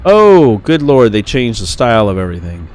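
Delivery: loud, real surprise; startled exclamation. Real, not acted. Player seeing the game's style change, loud surprise. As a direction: blurt it out loudly, astonished.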